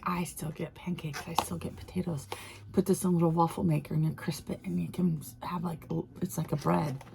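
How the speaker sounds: breathy hushed excitement, fast; whispering to the camera about waffle-maker bread; excited whisper. Real, not acted. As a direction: hush it like a delicious secret. Whispered excitement over potatoes.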